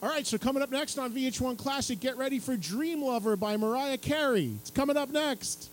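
bright on-air presenter read; TV host intro. Retold or imitated. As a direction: sell the video like a cheerful VJ. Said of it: "And I'm like".

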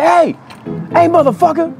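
high, loud yell through a laugh. Retold or imitated. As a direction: bellow the line, cracking up. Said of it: "Juvenile yells out".

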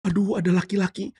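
gasp, then high swooning admiration; high-pitched infatuated gushing; smitten delight. Acted. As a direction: play a woman thrilled by a handsome man. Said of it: "istrinya Potifar senang sekali lihat Yusuf".

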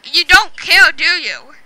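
loud, exasperated question; loud challenge. Real, not acted. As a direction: throw it loudly at a careless friend. Challenging friend who interrupts recording.

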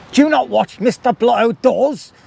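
high-pitched playful outrage; mock indignation. Mock indignation about a YouTuber.

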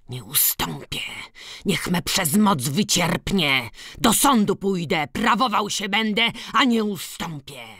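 almost shouting. A narrator's reading. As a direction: refuse stubbornly, nearly yelling. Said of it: "krzyczał prawie". (prawie krzycząc, z uporem)

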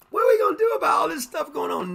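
high, fast mock panic. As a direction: play the frantic worrier, pitched high and rushed. Voicing panicking worried people.

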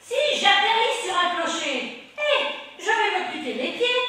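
high, loud laughing witch. Acted. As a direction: shriek it like a giggling witch. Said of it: "une voix de sorcière".

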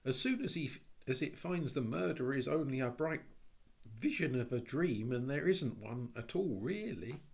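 put-on plaintive girl worrying aloud; mournful girl. A narrator's reading. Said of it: "said Anthea mournfully".

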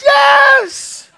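very high, loud imitated scream; hysterical scream. Sister-in-law asked if she wants Jesus, hysterical scream. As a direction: scream the yes as if falling apart. Retold sister-in-law's desperate acceptance.

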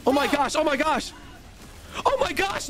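oh my gosh building from plain to a high cry; building excitement; rising excitement. Real, not acted. Rising disbelief at game chaos.

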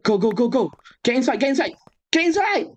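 panicked high-pitched shouting; frantic high yelling. Real, not acted. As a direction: shriek orders in a panic. Urgent calls to teammate.

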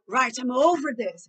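loud, slow exasperation; fed-up parent. Shown as a demonstration. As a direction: loudly declare you've had enough. (irritação em voz alta)

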